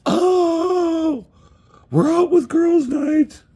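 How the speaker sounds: very high, loud mock party-girl squeal; hammed-up girls'-night shriek, drawn out; girls faking fun. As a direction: squeal it in fake excitement. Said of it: "these girls that fake it like".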